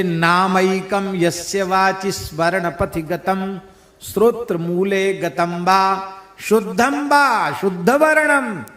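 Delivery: strong, rhythmic shloka delivery; Sanskrit recitation. Real, not acted. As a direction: recite the verse firmly in metre.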